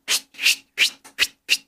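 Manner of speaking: voiceless, short whooshes of passing bullets; whizzing bullets. Retold or imitated. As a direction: imitate bullets flying past, breath only. Imitates bullets whizzing past. (חיקוי שריקת כדורים ללא קול)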